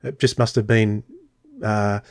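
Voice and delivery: ordinary talk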